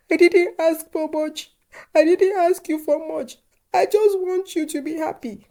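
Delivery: high, loud, tearful wail, slowed down; bursting into tears. A narrator's reading. Mother cries to her son over grandchildren, high and tearful. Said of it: "Olatanwa burst into tears and said".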